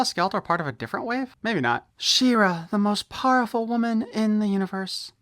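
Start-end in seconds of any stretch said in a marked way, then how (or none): 2.0-5.1 high, grand announcer read of the box text; packaging blurb read. Acted. Reviewer reading the back of the toy box to viewers, grand announcer style. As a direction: boom it out like a cartoon trailer.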